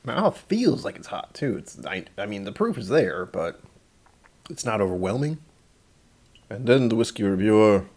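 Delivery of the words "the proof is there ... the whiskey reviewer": usual speaking voice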